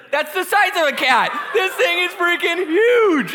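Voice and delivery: high, loud mock-horrified shouting; hammed-up shriek, last words stretched out; losing it. Acting out seeing the giant spider, hysterical mock shout. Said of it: "we are losing it".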